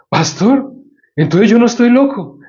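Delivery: retold startled question, pitched high; mildly startled. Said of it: "Jorge se asustó".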